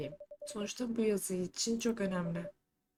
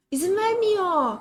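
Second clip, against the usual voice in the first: straining shout; 10 semitones higher, 10 dB louder, slower than usual. Acted. Struggling to hold the werewolf.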